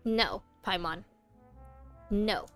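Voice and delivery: ordinary talk, nothing marked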